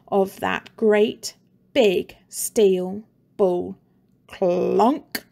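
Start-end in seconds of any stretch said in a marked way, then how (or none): none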